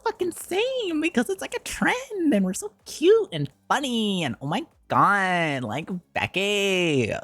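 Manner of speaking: mock a gushing trend-follower with a high voice; fake Valley Girl voice. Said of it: "come up with a fake voice".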